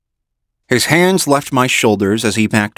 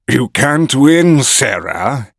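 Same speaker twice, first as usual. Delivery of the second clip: growl; 3 semitones higher, slower than usual. A narrator's reading. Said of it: "he growled".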